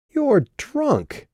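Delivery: sudden high surprised cry. Acted. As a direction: blurt it out in shock. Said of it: "couldn't keep surprise out of her voice".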